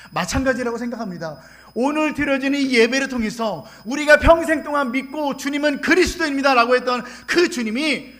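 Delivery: loud, high-pitched sermon climax; fervent preaching. Real, not acted. Missionary exhorts congregation to confess God's goodness, impassioned preaching. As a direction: preach it with earnest force, stretching key phrases.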